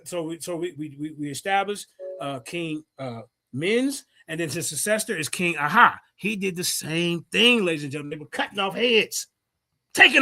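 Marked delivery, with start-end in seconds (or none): none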